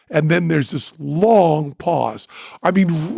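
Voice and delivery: ordinary talk, nothing marked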